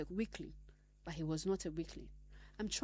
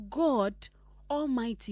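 louder, ringing proclamation; proclaiming; 4 semitones higher, 9 dB louder, slower than usual. Acted. Preacher proclaiming God Almighty to the church. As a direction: call out the name with weight.

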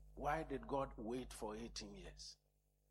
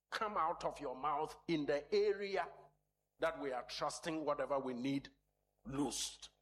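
louder, higher urgent exhortation; preaching at full force; 5 semitones higher, 4 dB louder than usual. Real, not acted. Preacher urging congregation to speak the word, urgent. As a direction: press the word home with force.

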